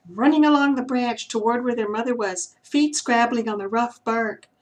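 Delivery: usual speaking voice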